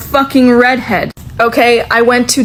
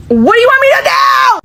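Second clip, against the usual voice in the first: exasperated; 13 semitones higher, faster than usual. Real, not acted. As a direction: throw up your hands and yell it, fast and high. Exasperated at her commenters.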